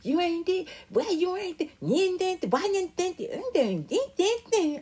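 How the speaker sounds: high, needling mocking voice; mom's nagging voice. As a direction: mimic mom picking at her. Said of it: "I don't have to call and hear that voice".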